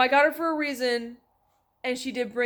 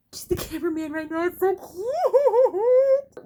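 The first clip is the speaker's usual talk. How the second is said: cooing at the kitten; 9 semitones higher, 6 dB louder, slower than usual. Real, not acted. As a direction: coo it sweetly at the kitten, very high.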